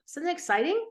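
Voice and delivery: high, bright rising question; excited. Real, not acted. Therapist enthusing to the host, high excited question. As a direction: let the excitement lift the whole question.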